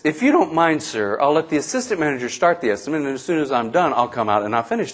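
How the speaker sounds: lightly voiced manager, low and soft; mild polite manager. Busy manager asking a customer to wait, mildly polite.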